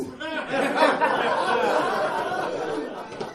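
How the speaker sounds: a burst of high laughter. Real, not acted. Speaker laughing while retelling the hospital denial, amused.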